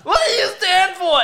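high, loud shouted question; mock yell. Retelling a movie scene to friends, high mock shout. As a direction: bellow the question like an action hero. Retold hero yelling a question.

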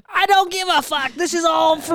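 high, carefree mock Becca voice, not caring at all; a defiant, devil-may-care voice pitched high; a hint of a wild driver who won't brake; reckless Becca. Voicing Becca's reckless retort.

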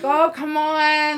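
high, loud, slow teasing plea; mock coaxing. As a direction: egg him on playfully. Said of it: "I'm like".

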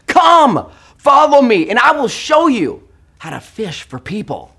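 high, loud call across the water. Acted. Jesus calling out loud to the fishermen. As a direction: call them to come follow. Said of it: "Jesus calls out to them".

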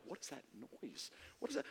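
very soft, low wondering; hushed, puzzled murmur; puzzled onlookers. Retold or imitated. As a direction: ask it under your breath. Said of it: "people are like".

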